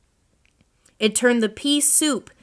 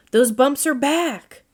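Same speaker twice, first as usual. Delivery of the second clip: higher, louder alarmed shout; 6 semitones higher, 4 dB louder than usual. A narrator's reading. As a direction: shout in dismay at the bumps. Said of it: "shouted Owl".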